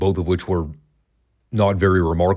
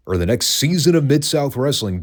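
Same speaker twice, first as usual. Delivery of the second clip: higher, salesy TV executive pitch; cheesy TV exec; 6 semitones higher than usual. Retold or imitated. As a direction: ham it up like an eager exec. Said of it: "came up to them and said".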